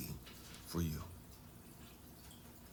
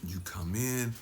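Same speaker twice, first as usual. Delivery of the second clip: ordinary talk, nothing marked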